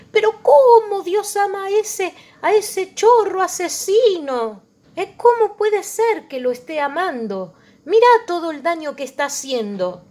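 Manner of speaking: high, loud indignant protest; outraged objector. Acted. A preacher voicing the congregation's outrage, high indignant cry. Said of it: "Y entonces uno dice".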